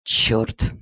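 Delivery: whispered under the breath; muttered curse. Acted. Fed-up parent reading the school chat, whispered curse. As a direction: hiss the curse to yourself, unvoiced. Mother reacts to chat message.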